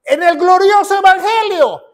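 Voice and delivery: high, ringing preacher's shout; proclamación exaltada. Real, not acted. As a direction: proclaim it to the congregation at high pitch. Predicador proclama su fe. (proclamación a gritos, en tono alto)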